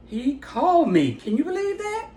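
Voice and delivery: high-pitched, thrilled disbelief; retold excitement. Mother telling daughter about the celebrity call, thrilled. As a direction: reenact breaking amazing news, voice climbing high.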